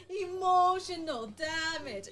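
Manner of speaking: slow, loud, drawn-out dramatic pronouncement. Retold or imitated. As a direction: boom out each word slowly like a verdict. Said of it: "and he's like".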